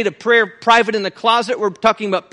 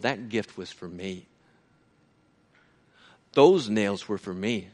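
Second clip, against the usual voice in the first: hushed sermon; 10 semitones lower, 6 dB quieter than usual. Acted. Preacher speaking tenderly to the brethren, soft and low. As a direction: bring it down to a near-hush. Preacher drops to intimate reflection.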